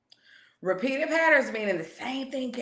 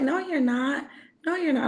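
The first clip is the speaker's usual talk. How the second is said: daughter protesting; 5 semitones higher than usual. Retold or imitated. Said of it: "And she like".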